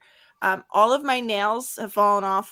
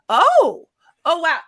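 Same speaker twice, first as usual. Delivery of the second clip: surprised; 7 semitones higher, 9 dB louder, slower than usual. Retold or imitated. Retold nurse reacting to blisters.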